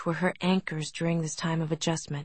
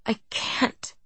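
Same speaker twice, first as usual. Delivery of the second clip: weeping; 4 semitones higher than usual. A narrator's reading. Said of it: "she wept, breaking into sobs".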